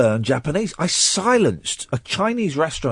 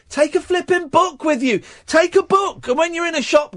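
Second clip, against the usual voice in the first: high-pitched, insistent scolding; exasperated rant; 13 semitones higher than usual. Real, not acted. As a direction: plead in exasperation, voice right up high. Ranting at lazy monolingual tourists.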